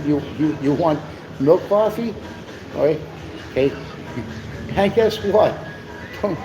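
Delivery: usual speaking voice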